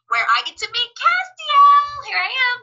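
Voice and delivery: high, loud drawn-out fangirl thrill; gleeful high-pitched announcement; excited squeal. Real, not acted. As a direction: stretch the name in rising excitement. Hyping own big scene.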